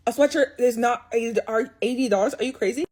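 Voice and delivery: usual speaking voice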